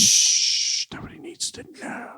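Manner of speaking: hushed whisper, no voice. Acted. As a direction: shush them like a secret. Secret shushing the co-host.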